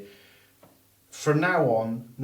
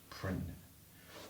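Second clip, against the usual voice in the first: whispered; 5 semitones lower, 15 dB quieter, much slower than usual. Shown as a demonstration. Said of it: "mid-list".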